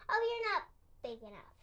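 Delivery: high, fast and dismissive; grouchy ladybug. A narrator's reading. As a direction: toss it off scornfully. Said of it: "said the grouchy ladybug".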